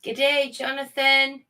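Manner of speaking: a clumsy attempt at an Australian twang, drawn out slow; hammed-up Aussie greeting; bad Australian accent. Mock Australian greeting to a viewer, bad Australian accent. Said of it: "That were a bad accent".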